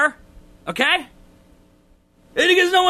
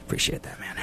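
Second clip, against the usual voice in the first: whispered thanks; 11 dB quieter, faster than usual. Pretend.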